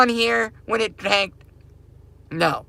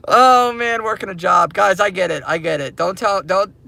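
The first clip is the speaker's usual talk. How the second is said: ordinary talk, nothing marked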